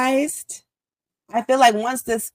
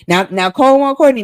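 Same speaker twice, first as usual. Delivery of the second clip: louder, punchy emphasis; emphatic; 5 semitones higher, 7 dB louder than usual. Real, not acted. Building emphasis.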